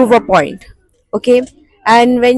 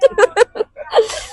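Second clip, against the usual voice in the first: sudden high laugh, half crying; high squealing laugh; 12 semitones higher than usual. Real, not acted. Laughing at friend pretending sleep.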